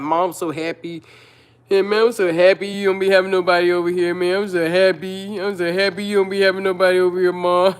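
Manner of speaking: put-on a light, quick boyish voice for her son; imitating her son. Son telling his mom he is glad, mildly imitated. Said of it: "he'll like".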